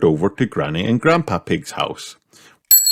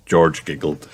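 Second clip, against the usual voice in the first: plain reading voice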